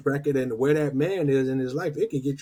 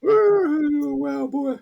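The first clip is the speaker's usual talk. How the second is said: shocked; 13 semitones higher, 4 dB louder, much slower than usual. Real, not acted. Reacting to the story.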